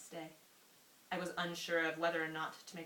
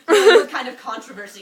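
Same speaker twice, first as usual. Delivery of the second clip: laughing; 6 semitones higher, 24 dB louder than usual. Real, not acted. Bursts out laughing reading.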